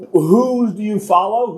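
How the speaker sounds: normal talking voice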